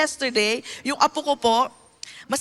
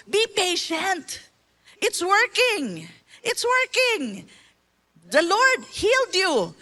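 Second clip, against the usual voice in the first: gentle reassurance; 6 semitones higher, slower than usual. Retold or imitated. Grandmother gently teaching child.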